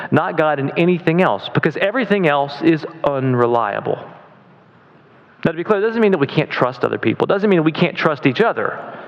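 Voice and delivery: ordinary delivery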